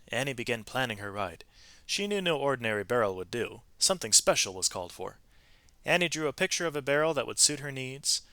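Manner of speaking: lively read-aloud storytelling voice; expressive storybook narration; story narration. A narrator's reading. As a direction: tell it like a picture book to children. Reads a picture-book story aloud.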